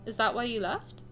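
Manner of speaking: reading the game's lines in a steady story voice. Acted. Streamer reading the heroine's lines aloud, steady storytelling. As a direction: read it out like a visual novel heroine.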